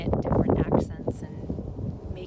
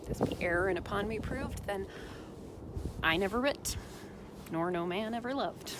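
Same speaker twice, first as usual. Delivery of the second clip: put-on Shakespeare sonnet read in a lightly Minnesotan accent; a hint of Minnesota in a dramatic reading. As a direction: recite the sonnet like a folksy Minnesotan. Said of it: "Sonnet one sixteen from a Minnesotan".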